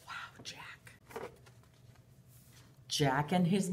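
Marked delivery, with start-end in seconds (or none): 0.1-0.9 whisper. Acted.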